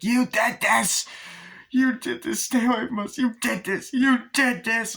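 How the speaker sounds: high, loud panicked cry of blame; imitating a hysterical wife screaming at her husband; terrified accusation. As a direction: shriek the accusation at him in horror. Wendy repeats accusation, terrified.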